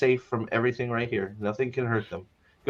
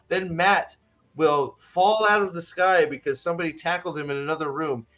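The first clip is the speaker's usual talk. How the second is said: ordinary talk, nothing marked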